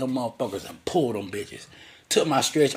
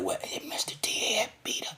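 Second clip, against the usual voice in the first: high whispered puzzled question; 10 semitones higher, 10 dB quieter than usual. Retold or imitated. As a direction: whisper it, baffled. Granddad asks, confused.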